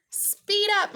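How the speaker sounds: high, urgent squeaky mouse; Marvin. A narrator's reading. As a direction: squeak orders like a hurried little mouse. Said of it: "Marvin squeaks".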